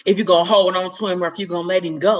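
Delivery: normal talking voice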